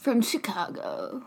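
slow, very soft, low put-on voice; would-be cool persona. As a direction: finish the alias slowly, almost under your breath. Continues the put-on Chaz introduction.

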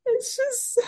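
high, teary squeak; emotional, tearful. Real, not acted. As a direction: let the words rise into a near-sob. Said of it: "I'm getting really emotional".

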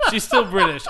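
breaking into laughter on still British; laughing. Real, not acted. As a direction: laugh through the words she's still British. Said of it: "I recognize what I did there".

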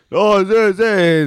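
loud, high-pitched mock excuse; imitated brush-off. Mimics quartermaster throwing out an excuse.

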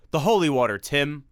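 loud choked cry; strained urgent cry; strangled cry. Acted. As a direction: force it out through the strain. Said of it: "he said in a strangled cry".

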